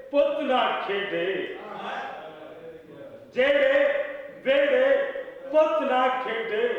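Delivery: high-pitched declaimed Punjabi verse; poetry recital. Acted. Poet reciting couplets at a mushaira, declaimed recital. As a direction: recite phrase by phrase, repeating lines for the audience.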